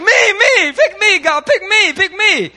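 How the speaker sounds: high, loud eager pleading; eager volunteers. Retold or imitated. Israelites begging God to choose them, high eager shout. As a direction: beg to be picked, loud and high. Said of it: "All the Israelites are like".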